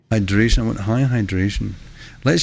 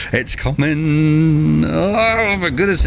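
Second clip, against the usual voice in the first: drawn-out, higher-pitched cry as it builds; excited alarm; 7 semitones higher, much slower than usual. Real, not acted. Streamer watching the blast arrive, drawn-out excited alarm. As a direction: stretch each word out, climbing in pitch.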